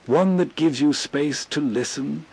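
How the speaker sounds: normal talking voice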